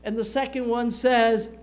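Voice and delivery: normal talking voice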